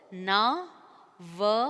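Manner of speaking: "November" as no... vem... ber; slow, separate beats on "November"; syllable by syllable. Shown as a demonstration. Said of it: "This how you can split these words".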